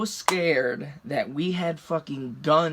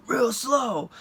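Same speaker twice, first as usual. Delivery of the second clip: higher-pitched imitation of a cop; mock police command in a raised pitch; cop's order; 9 semitones higher than usual. Cop directing an armed driver, imitated command.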